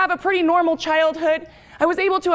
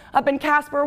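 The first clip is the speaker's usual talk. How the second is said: ordinary talk, nothing marked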